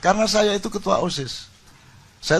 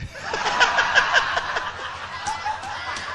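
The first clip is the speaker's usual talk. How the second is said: long high laugh. Real, not acted. Opening laugh from speaker.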